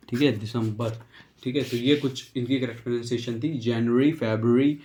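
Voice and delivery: ordinary talk, nothing marked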